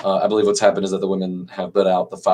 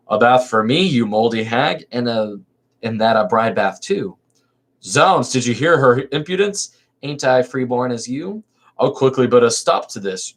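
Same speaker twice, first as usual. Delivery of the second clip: lively theatrical reading of a verse comedy; dramatic play reading; 3 semitones higher than usual. Acted. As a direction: read the chorus lines with stage energy. Reads play aloud dramatically.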